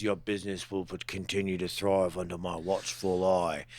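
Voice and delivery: low, soft crime-boss impression; Boba Fett. Crime boss promising the club protection, low impression. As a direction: speak low and quiet with measured authority. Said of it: "Boba Fett walks in there and goes".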